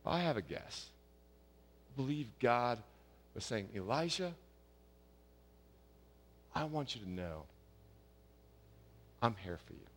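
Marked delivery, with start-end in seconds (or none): 3.7-9.9 soft, gentle voice of God, softer; gentle still voice. Retold or imitated. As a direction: speak it tenderly, like comforting a friend. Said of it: "in a gentle, compassionate, still voice".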